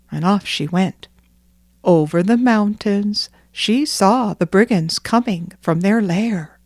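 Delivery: usual speaking voice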